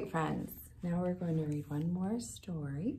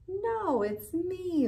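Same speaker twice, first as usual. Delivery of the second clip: laughing; 11 semitones higher, 3 dB louder, slower than usual. A narrator's reading. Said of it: "laughed Cousin Wilbur".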